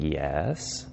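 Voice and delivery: soft, low start sliding up to a question; curious. Acted. Prospect takes their turn on the phone, curious and questioning. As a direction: answer tentatively with a rising tail. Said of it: "John is gonna be super curious".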